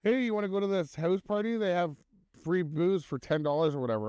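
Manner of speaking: high-pitched mimicked invitation; imitated party kid. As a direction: pitch it up like a mock eager youngster. Voices party kids inviting him.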